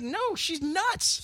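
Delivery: very high, drawn-out mock protest; high exasperated retort. Viewer answering the guys defending her, mock exasperated. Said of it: "It's like".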